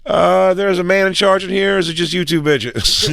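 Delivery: mock rude comic greeting strangers; brash loudmouth. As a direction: play the rude guy barging in. Tactless comic walking into reception.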